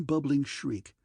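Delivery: usual speaking voice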